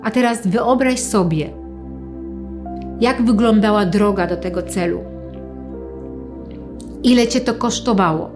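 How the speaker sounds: calm them with long pauses. Acted. Leads a guided visualization.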